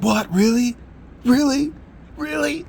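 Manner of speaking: imitating a girl who can't believe what she heard; stunned disbelief. Girl's extreme disbelieving reaction.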